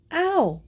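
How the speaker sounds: high-pitched yelp of pain; acted little cry of hurt; involuntary ow. A narrator's reading. Younger girl rubbed too hard with a towel, involuntary ow. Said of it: "an involuntary".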